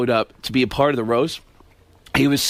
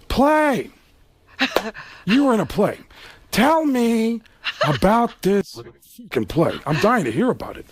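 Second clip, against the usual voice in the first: a mocking, higher Pacino with a slow build; Al Pacino impression; 6 semitones higher, slower than usual. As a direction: Pacino feigns ignorance with menace. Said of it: "and Al Pacino".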